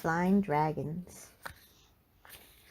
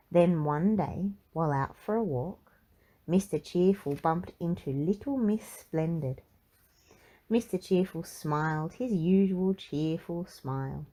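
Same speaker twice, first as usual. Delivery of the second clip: ordinary delivery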